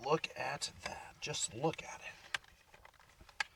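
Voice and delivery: slow hushed whisper; slow whispered marvel. Real, not acted. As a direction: breathe it out slowly. Admiring flat soda, whispered.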